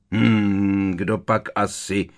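drawn out, pretending to ponder; mock thoughtful drawl. A narrator's reading. Harry baits the teacher with mock musing. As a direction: feign musing, then land the name slowly. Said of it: "protáhl Harry předstíraně přemítavým tónem".

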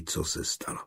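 breathy whisper of co se stalo, co leaned on. Acted. Johann whispers to his friend in line, secretly. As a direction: tell it under your breath. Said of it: "Šeptem pověděl Eliášovi".

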